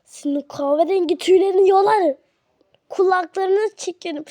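loud, scolding threat; angry threat. Acted. Big sister threatening to pull out fur, angry scolding. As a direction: threaten the sibling at full voice.

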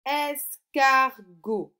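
very slow, loud, drawn-out word; slow dictation. Shown as a demonstration. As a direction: dictate it slowly, stretching each syllable. Teacher dictating words for sound exercise. (dictée lente et appuyée)

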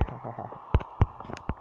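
quiet unvoiced chuckle; a soft smug laugh at the zombies; breathy laugh. Real, not acted. Taunting zombies.

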